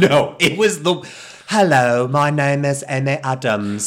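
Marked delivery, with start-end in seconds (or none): 1.5-3.9 over-enunciated fake British accent, raised pitch; mock British accent. Host mimicking the actress's fake British accent, mock British. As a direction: introduce yourself in hammed-up fake British. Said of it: "That fucking British accent".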